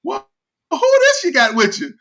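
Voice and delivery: high, loud pointed question; mock old-lady query about a newcomer; imitating wary relatives. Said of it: "they pause for a minute".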